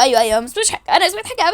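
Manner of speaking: usual speaking voice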